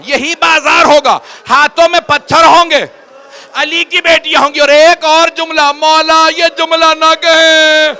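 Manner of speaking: loud, high, wailing preacher's lament; rising grief-stricken sermon, loud and slow; majlis recitation at full force. Acted. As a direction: cry the lament out to the mourners.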